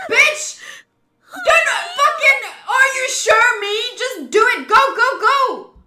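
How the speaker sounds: panicked real shouting; frantic yelling. Real, not acted. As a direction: scream at the characters to hurry up.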